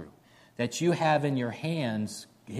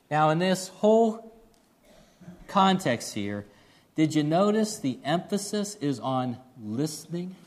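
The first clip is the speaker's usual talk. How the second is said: ordinary talk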